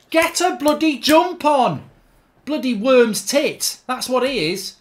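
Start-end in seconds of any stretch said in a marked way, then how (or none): 0.1-1.9 slow, high, loud scolding; exasperated yell. Real, not acted. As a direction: drag out the angry order. Yelling at his player to jump.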